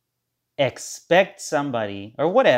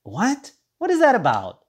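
baffled outburst; 10 semitones higher, 5 dB louder than usual. Real, not acted. Baffled by insulting cards.

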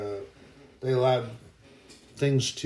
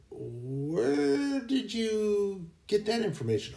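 slow, higher put-on man's voice, puzzled; coworker voice; 8 semitones higher, 5 dB quieter, slower than usual. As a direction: act the baffled coworker asking, drawn out. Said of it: "And he goes".

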